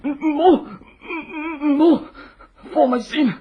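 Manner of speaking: loud, panicked begging for mercy; terrified pleading. Acted. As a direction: beg desperately, voice straining. Captive begs for his life.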